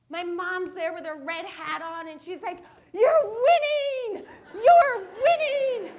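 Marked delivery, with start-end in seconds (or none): 2.9-5.9 slow, high, loud cheering mom; calling out. Retold or imitated. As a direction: mom yelling from the sidelines, stretched out.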